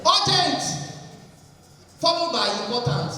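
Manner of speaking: emphatic answer. Real, not acted. Answers own quiz loudly.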